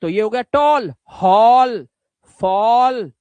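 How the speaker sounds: टॉल, हॉल, फॉल said loudly with an open ओ; ओ sound demo. Shown as a demonstration. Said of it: "देखो ध्यान से".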